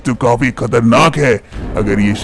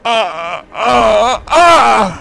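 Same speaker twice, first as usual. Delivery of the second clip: straining cries; 8 semitones higher, much slower than usual. Acted. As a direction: strain and cry out while pushing. Manisha pushing out an egg.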